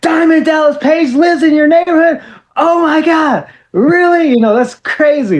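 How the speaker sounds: put-on teen learns his hero lives nearby; starstruck excitement. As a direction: squeal like a superfan hearing news. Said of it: "I was like".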